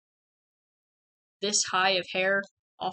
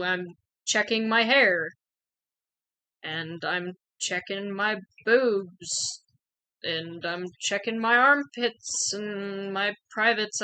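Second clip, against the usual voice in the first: slow, drawn-out announcing of each step; self-consciously narrating each move, slowly; awkward compliance; slower than usual. Retold or imitated. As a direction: stretch the words out, reluctantly playing along. Acting out the tick check aloud.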